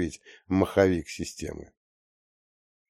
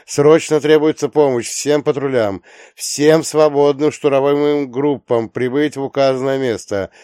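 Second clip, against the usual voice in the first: loud, higher radio alert; urgent dispatcher call; 5 semitones higher, 7 dB louder than usual. Acted. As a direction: announce it like an emergency dispatch.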